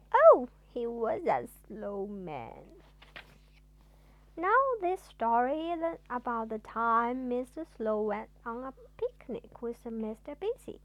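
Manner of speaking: plain reading voice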